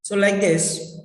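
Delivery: usual speaking voice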